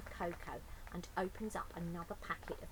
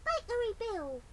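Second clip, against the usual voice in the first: put-on loud, high little child's call; baby calling; 10 semitones higher, 11 dB louder than usual. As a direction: call out proudly. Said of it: "says the baby, perfectly".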